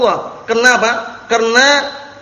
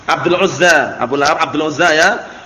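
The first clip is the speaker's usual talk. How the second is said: normal talking voice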